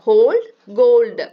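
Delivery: put-on ഹോൾഡ്, ഗോൾഡ് said slowly, matching the -old ending; slow rhyme pair. Shown as a demonstration. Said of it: "ഹോൾഡിന്റെ rhyming word".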